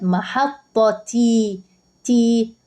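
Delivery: "محطة" drawn out with a voweled t ending, "تي"; final ta sound. Shown as a demonstration. Teacher showing the voweled ending, "محطة تي" slow with clear t. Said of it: "بنطقها تاء".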